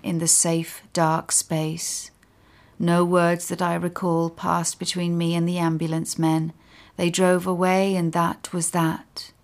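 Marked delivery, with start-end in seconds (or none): none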